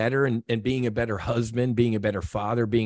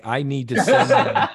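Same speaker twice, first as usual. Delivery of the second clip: laughing through the words; a real laugh breaking in; amused at his own retold thought; 4 semitones higher, 6 dB louder than usual. Real, not acted.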